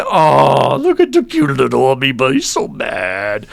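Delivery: gushing as if over a tiny cute creature; amused cooing. Acted. Person laughing at the furious little amoeba, amused cooing. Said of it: "You're laughing. You're going".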